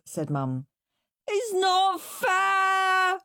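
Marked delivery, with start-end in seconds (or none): 1.3-3.2 slow, high, drawn-out wail. Acted. Henry wailing at Mum about chores. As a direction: wail it like the world is ending. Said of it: "wailed Henry".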